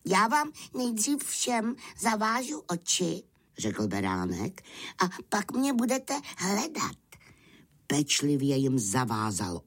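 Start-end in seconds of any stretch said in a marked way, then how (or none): 0.1-3.2 high-pitched lamb voice, instructing; clever little lamb. Acted. Lamb explains the rules. 5.0-6.9 high-pitched lamb voice, instructing; clever little lamb. Acted. Lamb explains the rules.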